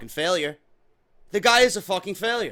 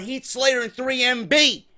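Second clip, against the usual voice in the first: ordinary delivery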